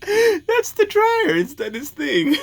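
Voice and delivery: high, breathless laughing voice; laughing through it. Real, not acted. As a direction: still cracking up while explaining the noise. Laughing fit over a review.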